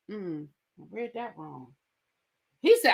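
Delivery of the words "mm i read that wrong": much softer, low embarrassed murmur; a hushed mock retreat; sheepish mumble. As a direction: back down quietly. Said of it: "Matt was like".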